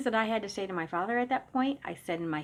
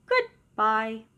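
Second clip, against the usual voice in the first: toy voice; 3 semitones higher, 5 dB louder than usual. Retold or imitated. Talking toy switching off.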